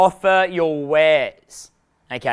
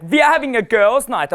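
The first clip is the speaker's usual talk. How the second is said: high-pitched fake German girl; bad German accent; 6 semitones higher, 3 dB louder than usual. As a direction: do a badly faked German accent. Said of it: "so really bad German accent".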